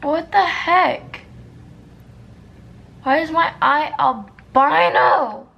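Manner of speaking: high, loud disbelieving complaint; exasperated outburst. Real, not acted. Complaining to the camera about the pale eye, loud and high. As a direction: throw up your hands, loud and high. Exasperated at her ruined makeup.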